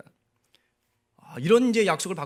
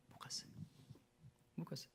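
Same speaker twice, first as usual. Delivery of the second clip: barely voiced, much softer; hushed answer; 22 dB quieter, faster than usual. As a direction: drop almost to a whisper for weight. Sad story climax, repeated answer.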